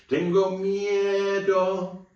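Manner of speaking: high, slow, hammed-up scared wail; be a bit silly, pretend to be scared; silly mock fear. Shown as a demonstration. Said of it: "say it like I am afraid".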